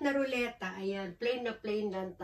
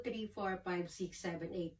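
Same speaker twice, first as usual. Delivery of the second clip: ordinary delivery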